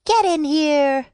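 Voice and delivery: high, loud call; calling the hens in. A narrator's reading. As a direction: call out to herd the hens inside. Jake calls the hens in.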